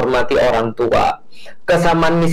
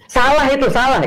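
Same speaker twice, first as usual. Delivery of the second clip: playful pun; 10 semitones higher than usual. Acted. Joking pun on Salah.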